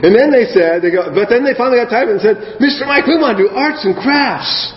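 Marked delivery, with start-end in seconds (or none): 2.6-4.7 put-on kids pleading. Kids asking, voiced high.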